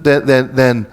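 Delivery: usual speaking voice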